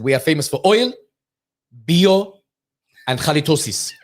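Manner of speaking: put-on Iranian-accented list with pauses between items. Comedian friend lists what Iran is famous for, Iranian accent. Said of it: "does the whole Iranian thing".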